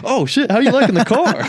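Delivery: sudden high, loud, laughing salesman pitch; salesman voice switch. Retold or imitated. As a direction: flip into a bright salesman act mid-laugh. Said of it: "voice change, like, his inflection".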